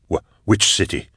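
ordinary delivery

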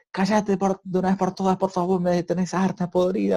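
put-on mother yelling at her crying baby, fed up and harsh. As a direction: snap at the baby like a mother at the end of her rope. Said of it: "y la mamá le dice".

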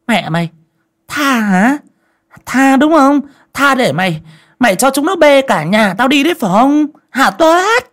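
very high, loud shrill tirade; shrieking yell. Acted. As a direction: screech at the boy in contempt. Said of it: "mà quát".

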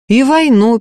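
plain reading voice

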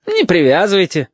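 high, falsely cheery echo; unnaturally chipper. A narrator's reading. Lieutenant echoes the boy's request, unnaturally chipper. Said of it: "ненатурально бодрым голосом".

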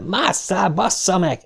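high-pitched urgent cursing order; restrained yell. A narrator's reading. Dick shoves Trev up to the window, yelling. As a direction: push with pitch, not volume. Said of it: "azt üvölti közben".

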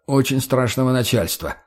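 ordinary delivery

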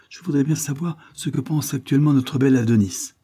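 un brin moqueur et plus haut; ironie. Acted. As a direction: piquer avec une ironie légère. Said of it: "avec un accent d'ironie". (la servante raille devant Isabelle, ton ironique)

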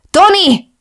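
loud, high call. Acted. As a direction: shout for the doorman. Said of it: "kiáltotta".

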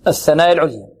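ordinary talk

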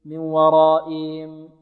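slow ورائهم ending "-iim" without h; swallowed ha. Shown as a demonstration. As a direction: wrongly drop the ha in ورائهم. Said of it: "لا نقول". (إخفاء الهاء في ورائهم)

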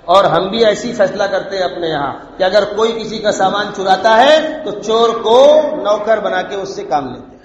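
normal talking voice

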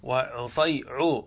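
وعصيع drawn out slowly, first syllables only; slow partial demo. Shown as a demonstration. Teacher building the diminutive, slow sounding out. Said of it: "عصفور".

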